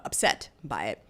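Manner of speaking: ordinary talk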